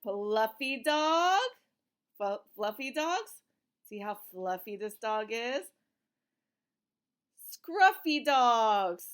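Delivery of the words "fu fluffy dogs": plain reading voice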